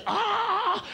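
high, loud "ah" of mock revelation; piercing cry of sighting; hammed-up scream of joy; excited scream. Acts band's cry on sighting him.

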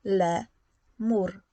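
Le and mur said as two separate beats; splitting into syllables. Shown as a demonstration. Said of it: "składa się z dwóch sylab". (podział na sylaby, Le - mur)

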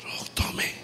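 barely voiced whisper. Booz whispering as he hands over the shoe. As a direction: slip it to her in a hush.

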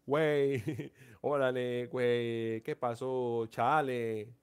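lightly put-on Mexican accent, a laugh mid-way; playful hint of a Mexican accent; mock Mexican slang. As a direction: toss off güey and órale like a Mexican buddy, half joking. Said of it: "Así hablan los mexicanos".

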